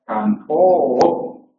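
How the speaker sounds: Campo drawn out as kampoo, stress on cam, rising; slow teaching demo of Campo; stress pattern demo. Shown as a demonstration. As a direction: demonstrate the accent on cam in Campo. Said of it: "el esquema acentual sería así".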